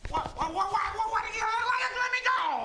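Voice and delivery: stammering, rising into a yell; panicked shout. Acted. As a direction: panic as you're grabbed, then shout. Grabbed by the collar.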